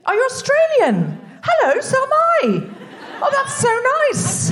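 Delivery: high, laughing, slow delight; light up at meeting a fellow Aussie. Real, not acted. Delighted discovery of compatriot.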